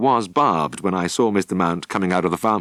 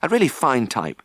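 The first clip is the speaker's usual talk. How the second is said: nervous; 8 semitones higher than usual. Acted. Jim nervously praising to farmer.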